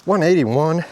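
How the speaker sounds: straining, loud and high; forced out mid-climb; cycling hard. Real, not acted. Exertion on climb, heart rate.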